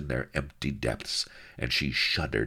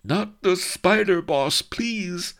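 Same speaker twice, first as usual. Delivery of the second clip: high, loud frantic begging; terrified plea; 6 dB louder, slower than usual. A narrator's reading. Chamberlain begging his boss, high frantic plea. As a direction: squeal for mercy like a cornered coward.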